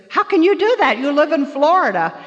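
high, loud mock-incredulous question; imitated surprised challenge. Others challenge her in surprise.

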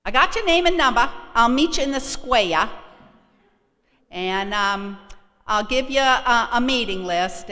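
loud, high, fast Boston woman; bossy volunteer rattling off plans; brassy Martha. Retold or imitated. Martha arranging to meet her in the square, loud and brassy.

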